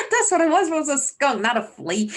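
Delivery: delighted a viewer caught her mix-up; bubbling over with amused relief; amused excitement. Real, not acted. Viewer corrects her, she laughs.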